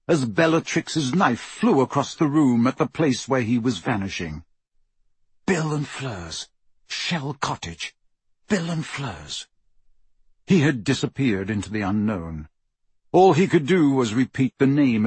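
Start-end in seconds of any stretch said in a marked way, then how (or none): 5.5-9.5 soft, slow incantation; hushed repetition. A narrator's reading. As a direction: repeat it under your breath, slowly. Silently repeating the destination.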